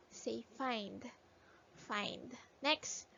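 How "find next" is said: ordinary delivery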